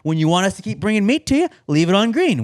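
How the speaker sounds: imitating a waiter's practised welcome; restaurant server spiel. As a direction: explain it in a put-on server voice. Imitating server explaining the system.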